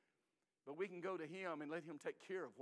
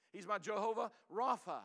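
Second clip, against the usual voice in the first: ordinary talk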